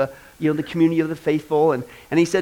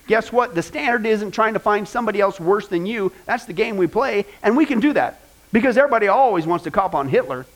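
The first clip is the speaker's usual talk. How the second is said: usual speaking voice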